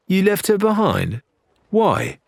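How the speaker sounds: surprised. Acted. Larina shocked at abandoned crewmate.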